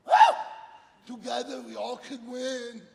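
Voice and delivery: high, loud whoop and cheer; triumphant child. Retold or imitated. Girl crosses finish line with friends, high triumphant cheer. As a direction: cheer it out like an elated little girl. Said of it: "She goes".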